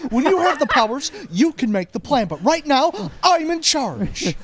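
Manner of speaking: high, loud gloating ruler voice; mock villain boast. Boasting to friends while gaming, loud mock tyrant. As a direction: lord it over them, grandly.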